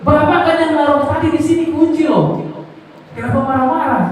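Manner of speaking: high, pointed complaint at the husband; mock scolding wife. Preacher voicing a wife blaming her husband, high and indignant. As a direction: play the wife snapping back about the keys.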